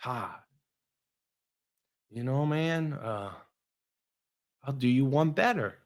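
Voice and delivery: soft, low voice with long pauses; calm. Retold or imitated. Calm coach offering Todd a better deal, calm. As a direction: imitate an unruffled, calm coach. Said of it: "he's really calm".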